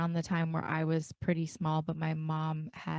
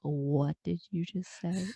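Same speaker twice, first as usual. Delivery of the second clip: stern mom; slower than usual. Retold or imitated. Shocked mom hears swearing.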